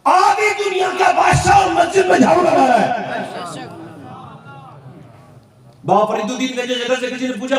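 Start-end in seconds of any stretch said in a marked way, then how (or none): 0.0-3.6 high, slow amazed cry; amazed exclaim. Real, not acted. Preacher to congregation, crying out in slow amazement. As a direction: marvel aloud at the emperor sweeping.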